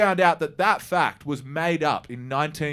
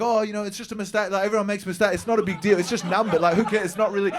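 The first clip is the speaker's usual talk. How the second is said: overly polite reassurance; 6 semitones higher, faster than usual. Retold or imitated. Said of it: "They were like".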